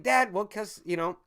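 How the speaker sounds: ordinary talk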